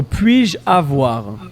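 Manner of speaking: slow, careful French model; over enunciating. Shown as a demonstration. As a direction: spell it out slowly for learners.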